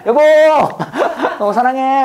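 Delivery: high, loud call to the wife; mock-lovey shout with a laugh; playful calling out. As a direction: sing out to your wife, laughing. Playfully calls out to his wife.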